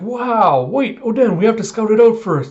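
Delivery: high, loud, urgent call after someone running off. Retold or imitated. Crew calling Oden back before he runs off, urgent and loud. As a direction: shout at him to wait.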